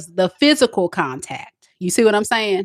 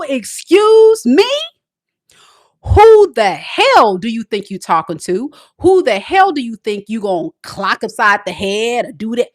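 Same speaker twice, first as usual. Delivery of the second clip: angry confrontation; 3 semitones higher, 3 dB louder than usual. Retold or imitated. Woman confronting her boyfriend, loud and furious. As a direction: put him in his place hard. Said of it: "let them know".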